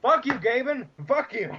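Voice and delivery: high-pitched playful cursing; mock outrage. Fan cursing the absent Valve boss, high mock outrage. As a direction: curse him out in hammed-up exasperation.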